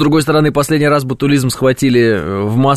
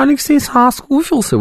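much higher mock female voice. Shown as a demonstration. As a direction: squeak it out like a woman. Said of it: "женским голосом по-другому отвечать". (высоким женским голосом, с насмешкой)

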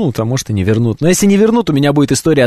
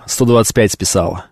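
ordinary talk

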